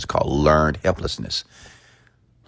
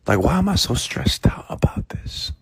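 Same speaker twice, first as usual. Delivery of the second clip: higher, whispered fretting; mock worried whisper; 8 semitones higher than usual. Speaker imitating anxious self-talk, whispered and higher. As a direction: whisper the worry like a nagging thought.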